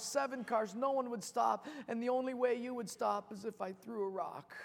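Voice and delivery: high, urgent, breathless-sounding plea; frightened boy pleading. Acted. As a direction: beg a stranger to save your brother. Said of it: "little kid about eight years old just shaking".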